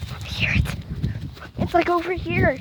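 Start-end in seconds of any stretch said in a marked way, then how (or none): 0.1-0.8 whisper. Real, not acted. Hushed while searching for cat.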